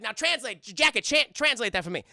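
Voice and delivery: Chris Tucker. Retold or imitated. Chris Tucker ordering Jackie to translate, loud call. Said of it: "And he's like".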